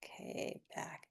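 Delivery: whispering. Real, not acted. Quiet aside during tapping.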